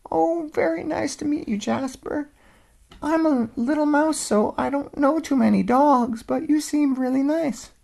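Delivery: high, tiny mouse voice; little voice. Acted. Mouse answering the old dog, tiny and high. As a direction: squeak it out small.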